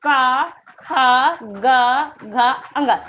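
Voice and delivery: का, खा, गा, घा very slow, vowels held; articulation demo. Shown as a demonstration. Teacher showing where sounds come from, का, खा, गा, घा drawn out. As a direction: stretch का, खा, गा, घा evenly, end on a quick अंगा. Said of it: "इसका उच्चारण स्थान देखिए कहाँ से हो रहा है".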